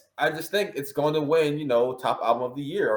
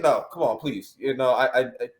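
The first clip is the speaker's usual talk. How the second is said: ordinary talk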